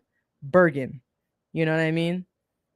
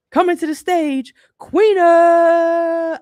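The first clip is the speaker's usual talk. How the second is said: big booming emcee call, louder and higher; stage announcer; 9 semitones higher, 7 dB louder, much slower than usual. Pretend. Host introducing her to the crowd, booming announcer call. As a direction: hype it like an announcer bringing her out. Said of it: "if I'm on stage".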